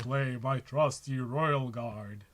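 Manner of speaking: slow, grandly hammed-up card duel declaration; Yu-Gi-Oh duelist. Player declaring a card to the opponent, mock anime duelist. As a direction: announce the card like an anime hero, drawn out. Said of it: "This feels like an episode from Yu-Gi-Oh!".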